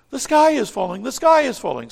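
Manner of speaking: high and loud, frantic cry; frantic alarm. Acted. Chicken Little frantically warns Henny Penny, high alarmed cry. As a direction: sound the alarm in a fluster.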